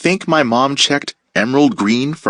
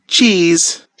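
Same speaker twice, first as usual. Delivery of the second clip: bright, higher drawn-out Cheese; mock photo cheese; 7 semitones higher than usual. Classmates posing for the camera, a chirpy cheese. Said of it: "everyone say".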